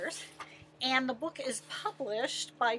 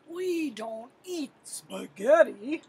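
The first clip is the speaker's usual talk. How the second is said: growling lion; 4 dB louder than usual. Acted. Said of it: "The lion growled".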